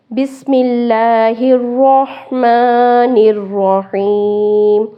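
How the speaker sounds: slow melodic tajweed recitation; Quran recitation. Shown as a demonstration. Teacher opening the lesson in slow recitation. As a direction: chant it slowly with tajweed.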